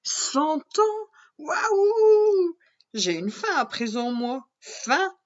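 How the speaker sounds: high, loud, wide-eyed princess voice; be amazed, then suddenly hungry; squealing princess surprise; astonished princess. Acted. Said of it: "interroge la princesse".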